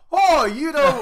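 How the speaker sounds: high, loud scoffing disbelief through a laugh; mock offended customer. Imitating a bar customer amazed the barman doesn't know the drink. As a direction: scoff like a customer shocked you don't know. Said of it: "they'll go".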